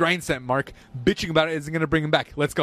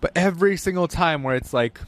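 normal talking voice

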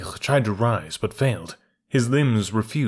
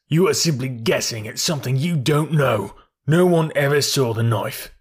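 defiant denial; 4 semitones higher than usual. A narrator's reading.

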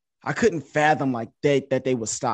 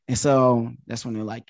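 ordinary talk

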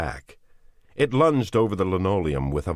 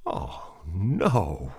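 voice breaking; 4 semitones higher than usual. A narrator's reading. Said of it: "his voice breaking".

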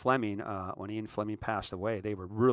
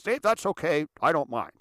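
higher, louder brush-off; pitched-up 'no worries' reply; acted reassurance; 5 semitones higher, 5 dB louder than usual. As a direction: wave it away loudly. Acts out a reply.

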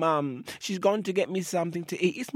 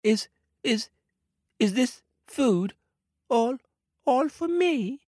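high, stammering wonder; baby crocodile stunned by the picnic, high and stammering; amazed little crocodile; 12 semitones higher than usual. Acted. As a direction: squeak it out in disbelief.

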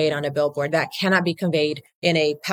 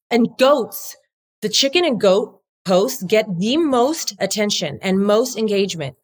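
normal talking voice

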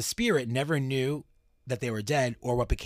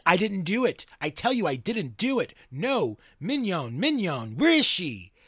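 raised, higher, pleading outcry; a light take on a ghost protesting his innocence; accused spirit crying out that he didn't do it; 7 semitones higher, 4 dB louder than usual. Acted. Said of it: "The entity cried out".

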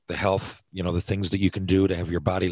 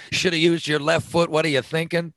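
lightly put-on British commentator scolding; mock a British pundit's jab; British announcer accent; 8 semitones higher than usual. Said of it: "in their British accent".

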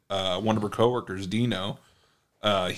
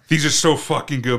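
laughing through the heat; 4 semitones higher, 6 dB louder than usual. Retold or imitated. Eating very spicy shrimp.

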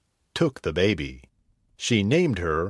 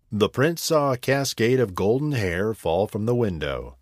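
ordinary delivery, nothing marked

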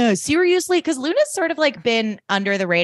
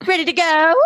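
higher, louder, sudden sunny chirp; bright chirp; 10 semitones higher, 4 dB louder than usual. Acted. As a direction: snap into fake cheer. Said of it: "she brightly turns to Helena".

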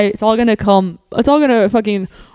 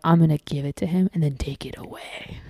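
scheming whisper; 4 semitones lower, 9 dB quieter than usual. Retold or imitated. Whispered revenge plan thought.

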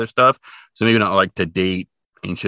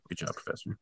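whispered, dry mock praise; sarcastic whisper; 19 dB quieter than usual. Real, not acted. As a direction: breathe it out as a wry put-down. Sarcastic aside about professor.

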